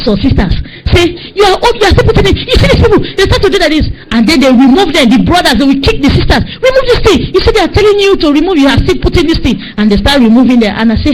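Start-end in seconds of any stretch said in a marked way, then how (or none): 1.3-3.9 high-pitched, agitated scolding; acted scolding. Boys berating sisters still putting it on, scolding. 6.6-9.6 high-pitched exasperated command; acted angry order. Brothers ordering sisters to remove it, scolding.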